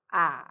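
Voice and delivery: "ah," dipping low then rising; third tone ah. Shown as a demonstration. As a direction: dip "ah," down and back up.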